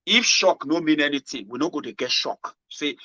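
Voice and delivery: ordinary talk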